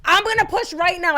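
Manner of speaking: high, loud retold announcement; urgent insistence. Mother warning the nurse before pushing, urgent insistence. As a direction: insist it cannot wait.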